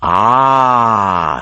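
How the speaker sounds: the dragon takes notice. A narrator's reading. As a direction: dragon turning to Geneva. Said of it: "said the dragon".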